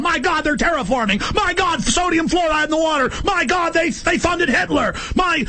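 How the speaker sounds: high, shouted panic about terraforming and Hitler; near-hysterical mock panic, yelling high; freaked-out outburst. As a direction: cry out in alarm, voice way up. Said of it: "I'm freaked out. I'm going".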